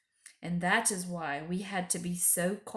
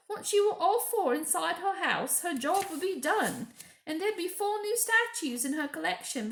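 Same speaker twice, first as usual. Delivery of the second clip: high, fussy motherly beaver wife; a busy animal mother scolding kindly; Mrs Beaver; 8 semitones higher, 4 dB louder than usual. Acted. Mrs Beaver warning the children, high and urgent.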